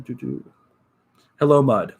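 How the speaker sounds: ordinary delivery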